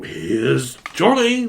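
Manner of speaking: slow, menacing "Here's Johnny"; Jack Torrance. Pretend. Quoting The Shining's axe killer, slow and menacing. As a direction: drawl it like a madman at the door.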